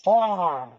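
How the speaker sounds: long high exhale after a harsh shot; imitated gasp at hot liquor; drawn-out "ahh", falling from high; burning-throat "ahh". Imitates reaction to hot whiskey.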